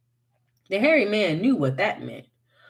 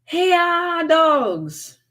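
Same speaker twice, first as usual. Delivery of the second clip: high, loud call to the hounds; hollering for dogs; 10 semitones higher, 6 dB louder, much slower than usual. A narrator's reading. Wiley calling his hounds to come. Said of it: "hollered again".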